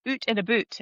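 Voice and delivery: out and about with Scottish vowels; playful Scottish pronunciation; Scottish accent. Shown as a demonstration. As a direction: put on a Scottish "oot and a boot". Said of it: "as we say in Scotland".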